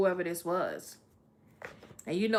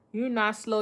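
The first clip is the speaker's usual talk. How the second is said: ordinary talk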